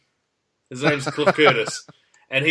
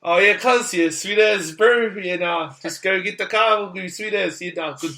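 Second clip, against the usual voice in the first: hammed-up broad Kiwi, pitched up; 4 semitones higher than usual. Shown as a demonstration. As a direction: lay the Kiwi slang accent on thick. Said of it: "Our version is".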